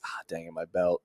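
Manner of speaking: low, soft grumble to oneself; muttered self-annoyance. Acted. Traveler remembering the belt at security, low annoyed mutter. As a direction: mutter it like a minor slip-up. Airport-goer realizing belt was forgotten.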